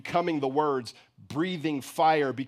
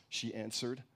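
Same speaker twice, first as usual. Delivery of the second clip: ordinary delivery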